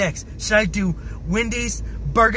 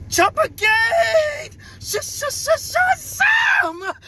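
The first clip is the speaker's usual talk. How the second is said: hysterical shout; much slower than usual. Real, not acted. Hysterical after prank.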